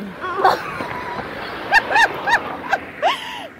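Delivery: high, helpless burst of laughter; real shrieking laughter. Real, not acted. Child fails the question.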